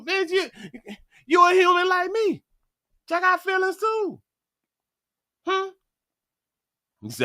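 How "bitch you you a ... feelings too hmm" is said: high, hurt, indignant woman's voice; mock offended woman. Woman scolding a man who asked her age, high and indignant. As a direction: play the insulted woman, pitched way up.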